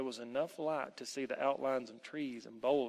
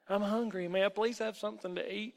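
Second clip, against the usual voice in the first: higher-pitched polite plea; meek boy; 7 semitones higher than usual. Acted. Hungry boy asks the elf for food, meek. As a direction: ask timidly, pitched up like a child.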